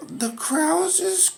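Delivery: very high, slow, halting pupil voice; pupil Dennis. Acted. Pupil answering the teacher's grammar question, high and halting.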